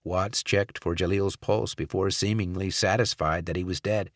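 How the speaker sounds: ordinary delivery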